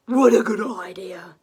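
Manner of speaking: loud, lightly voiced eager dragon; Zog the dragon. Zog eagerly accepting the girl's offer, loud. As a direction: answer like a keen young dragon. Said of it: "said Zog".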